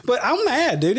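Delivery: usual speaking voice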